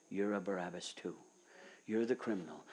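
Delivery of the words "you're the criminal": ordinary talk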